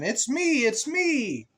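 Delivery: high, cheery little duck voice. Acted. Duck revealing itself to the child, high and cheery. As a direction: chirp it like a proud duck. Hen answers in story.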